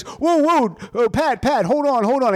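comic impression of Barry pleading on the phone; panicked backpedal. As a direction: imitate a caught man scrambling to calm things.

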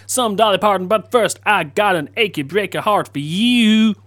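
put-on twangy American country radio host accent. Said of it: "doing it like this and being all American".

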